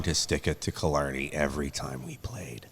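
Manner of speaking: hushed grudge; whispered confession; whispering. Real, not acted.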